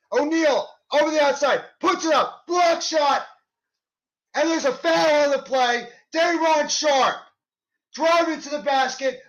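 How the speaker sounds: rising, excited call of each play; live play-by-play. Acted. Fan calling the Nets game play by play, excited. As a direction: call the action as it happens.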